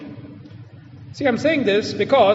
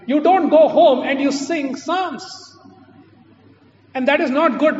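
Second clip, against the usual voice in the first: emphatic rebuke; 4 semitones higher, 5 dB louder than usual. Real, not acted. Rebuking congregation.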